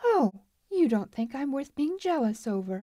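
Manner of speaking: put-on high, affronted girl's voice; chilly offence. A narrator's reading. Marla challenges Lou's denial, offended. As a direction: take offence and throw it back. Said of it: "Her eyes chilled a little".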